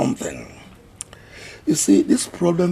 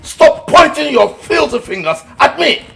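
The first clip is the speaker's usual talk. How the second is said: much higher, louder outrage; indignant shout; 13 semitones higher, 9 dB louder than usual. Acted. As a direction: snap back in outrage. Accused elder lashes back.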